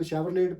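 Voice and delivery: शेवरलेट as "shevar-layt", final t sounded; wrong "SHE-var-layt" for शेवरलेट; naive misreading. Shown as a demonstration. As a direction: read शेवरलेट just as written, with a hard t. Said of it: "actual word ये नहीं है".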